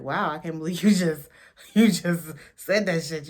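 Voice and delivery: loud, laughing disbelief at a betrayal; loud shocked disbelief. Retold or imitated. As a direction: blurt it out loudly, half laughing and stunned. Wendy's shocked reaction retold.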